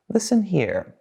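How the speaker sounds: normal talking voice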